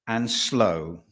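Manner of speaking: ordinary delivery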